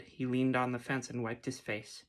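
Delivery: plain reading voice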